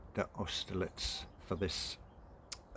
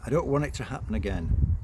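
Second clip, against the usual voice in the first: mother's voice; 6 semitones higher, 7 dB louder than usual. Acted.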